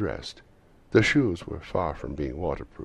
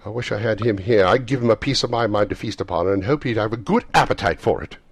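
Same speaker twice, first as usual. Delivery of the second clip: loud, raised, scolding tirade; indignant wife; 6 semitones higher, 9 dB louder than usual. A narrator's reading. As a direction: rant crossly at an absent miser. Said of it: "cried Missus Cratchit, reddening".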